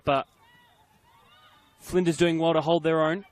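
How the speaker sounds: ordinary talk, nothing marked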